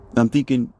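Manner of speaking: ordinary talk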